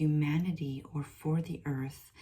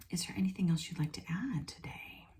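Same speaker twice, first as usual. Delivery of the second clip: whisper; 3 semitones higher, much faster than usual. Real, not acted. Whispered question to spirit.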